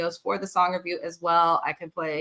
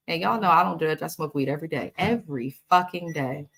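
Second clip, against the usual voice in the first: ordinary delivery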